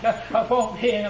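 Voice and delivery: ordinary talk, nothing marked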